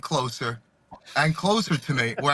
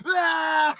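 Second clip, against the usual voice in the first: high, loud retold yell; mock yell; 10 semitones higher, 4 dB louder than usual. Jimmy banging on the sauna glass, a high mock yell.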